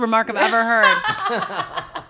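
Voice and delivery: mock outrage through laughter; laughing outrage. Real, not acted. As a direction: laugh while protesting.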